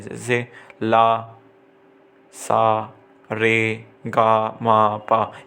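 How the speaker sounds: ला, सा, रे, गा, मा, पा said slowly, one syllable at a time like sargam notes; slow sargam-style syllable demo; syllables spelled out. Shown as a demonstration. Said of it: "syllables के form में लिखे जाते थे".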